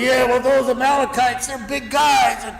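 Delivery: hammed-up moaning about Egypt; mock whiny complaint, rising to a shout; whining Israelites. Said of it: "they're grumbling".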